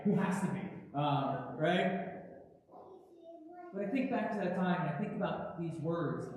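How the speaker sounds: ordinary talk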